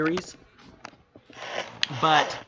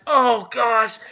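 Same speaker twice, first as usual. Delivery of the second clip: higher, louder mock groan; exasperated dismay; 4 semitones higher, 5 dB louder than usual. Reader reacting to the villain's scheme, exasperated dismay. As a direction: act out being appalled by a villain.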